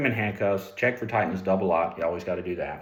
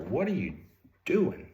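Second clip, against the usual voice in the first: doing jumps far up in pitch in disbelief; retold stunned what are you doing; incredulous; 7 semitones higher than usual. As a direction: recall your shock at a partner. Disbelief at partner's threat.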